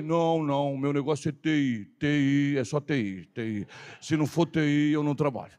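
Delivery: low mock voice of a picky idler; work-shy man. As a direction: turn down the job in a low, lazy voice. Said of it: "um cara que não gosta de trabalhar". (voz grave de preguiçoso, imitando)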